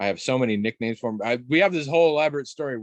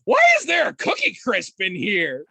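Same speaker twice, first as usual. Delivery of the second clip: high, louder puzzled mom; 9 semitones higher, 7 dB louder than usual. Retold or imitated. Mom finds cereal in the piggy bank, puzzled call. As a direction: call it out like a baffled mother.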